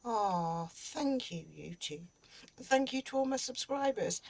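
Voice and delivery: soft, awed and close to tears; moved and emotional. Real, not acted. Creator thanking YouTube for the award, softly emotional. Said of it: "I'm just getting really emotional".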